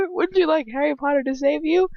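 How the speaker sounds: high mocking taunt, rising at the end. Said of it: "Previously on Days of Our Lives".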